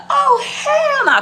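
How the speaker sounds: high, loud, drawn-out protest; retold indignation. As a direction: exclaim it with a stretched, squealed "hell". Retells competitive outrage.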